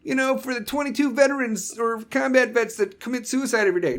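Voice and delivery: high, chirpy mock enthusiasm; mocking do-gooder. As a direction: parody a smug gesture. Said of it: "don't do that stupid shit like".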